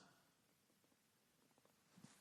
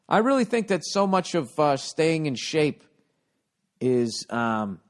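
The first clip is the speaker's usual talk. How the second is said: ordinary talk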